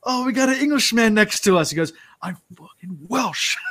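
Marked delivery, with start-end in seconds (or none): 0.0-1.7 high, loud retold call; radio call-out. Sailor reporting a neighbouring boat, imitated high loud radio call. 2.2-3.6 mock indignation. Offended Welshman answers on radio.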